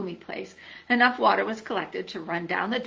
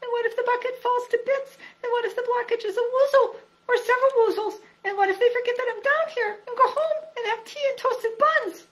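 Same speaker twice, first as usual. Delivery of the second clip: high, worried piping voice; a little pig talking himself into a panic; fretful Piglet; 4 dB louder than usual. A narrator's reading. Piglet alone in the dark well listing his fears.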